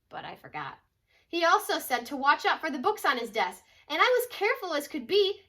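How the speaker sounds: put-on storybook read-aloud voice for kids; reading to children. Mom reading a picture book to her kids, storytelling voice. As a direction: tell it like a bedtime picture book.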